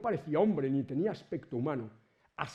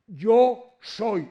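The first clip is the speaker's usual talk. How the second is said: solemn loud declaration; 6 semitones higher, 11 dB louder than usual. Acted. Jesus answers the soldiers.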